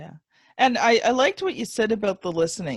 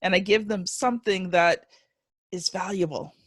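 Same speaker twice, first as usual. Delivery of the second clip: ordinary talk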